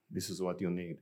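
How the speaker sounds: a low, lightly Russian clerk's voice; hint of a Russian accent, low and soft. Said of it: "he becomes Russian in my story".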